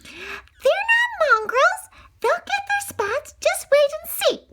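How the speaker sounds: Nanny protests the puppies will get spots; shrill protesting nanny; Nanny exclaiming. Acted. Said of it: "Nanny exclaimed".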